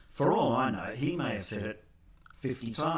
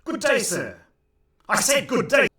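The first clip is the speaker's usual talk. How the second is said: building higher and louder, indignant; huffy dismissal; 11 semitones higher, 11 dB louder than usual. Retold or imitated. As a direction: storm off with an outraged good day. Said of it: "a more appropriate response".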